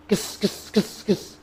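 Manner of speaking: soft whispered "kis, kis", no final "na"; rapid sibilant bursts for কৃষ্ণ; swallowed Krishna. Retold or imitated. Teacher imitates some devotees, hissing কৃষ্ণ as "kis". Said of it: "কৃষ্ণ না বলে কৃষ্ণ".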